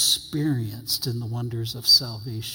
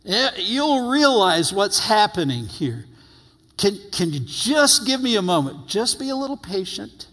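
higher, gentle voice of a patient Lord; patient Jesus; 6 semitones higher than usual. Retold or imitated. Jesus gently asking Peter to wait, patient and higher. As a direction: coax him kindly to wait. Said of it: "I love the Lord's patience. He goes".

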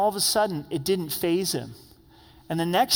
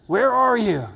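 loud, high yelling demand; angry parent; 5 semitones higher, 7 dB louder than usual. Shown as a demonstration. Preacher demos God as a parent yelling at a child. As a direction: yell it like a furious parent calling a kid. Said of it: "the tone of an angry parent".

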